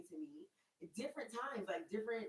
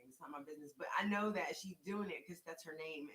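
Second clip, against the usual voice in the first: ordinary talk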